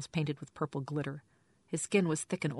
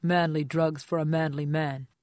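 smirking; 5 dB louder than usual. Acted. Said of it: "He smirked at me".

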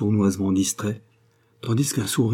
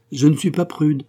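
raised, louder, lofty denial; haughty, higher-pitched scorn; disdainful retort; 7 semitones higher, 5 dB louder than usual. A narrator's reading. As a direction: brush the idea off with disdain. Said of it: "répliqua-t-elle dédaigneusement".

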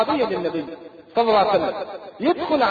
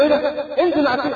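startled, higher-pitched challenge; alarmed order in a raised pitch; 7 semitones higher than usual. Acted. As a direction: act out being taken aback, voice jumping up. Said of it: "فمرستم اتخض".

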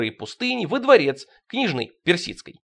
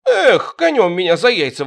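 louder astonished cry; louder amazed exclamation; exclaiming; 3 semitones higher, 7 dB louder than usual. Acted. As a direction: burst out in amazement. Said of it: "восклицает перс Галушкин".

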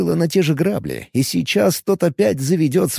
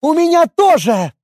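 high, loud outburst; flaring up; 5 dB louder than usual. Acted. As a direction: blurt it out hotly. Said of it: "вспыхнула".

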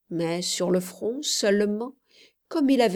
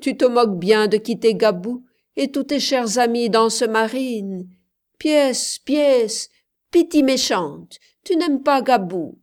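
louder, higher teasing reproach; playful scolding; 4 semitones higher, 6 dB louder than usual. A narrator's reading. Commandeur reproaching the child for leaving, mock scolding.

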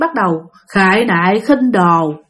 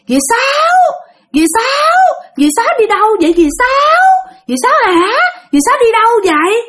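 much higher, eerie, crying call; shrill ghostly call; 12 semitones higher than usual. A narrator's reading. Ghost calls to Aunt Sáu by the tree, high wailing call. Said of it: "tiếng kêu lanh lảnh".

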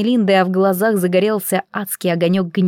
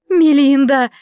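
loud, high pleading call; pleading cry; 5 semitones higher, 5 dB louder, slower than usual. A narrator's reading. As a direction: beg her by name. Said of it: "просила она".

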